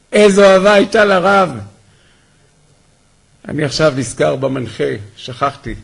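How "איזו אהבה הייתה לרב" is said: higher and louder, full of feeling; קריאה נרגשת ורמה; emphatic repeat. Shown as a demonstration. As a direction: cry it out the second time with awe.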